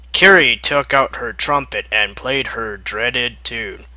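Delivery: normal talking voice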